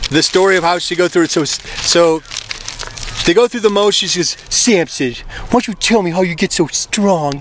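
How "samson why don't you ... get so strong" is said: lightly voiced coaxing woman; wheedling Delilah. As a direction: sweet-talk him for his secret.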